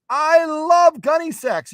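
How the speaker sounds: very high, loud, drawn-out fan gush; hammed-up squeal of enthusiasm, stretched slowly; over-excited viewer. Acting a viewer, escalated repeat.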